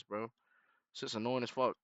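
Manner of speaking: ordinary talk, nothing marked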